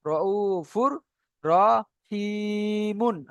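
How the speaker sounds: "র উ ফুর", then "রহিমুন" with a very long i; piece-by-piece recitation. Shown as a demonstration. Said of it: "আলাদাভাবে উচ্চারণ হচ্ছে".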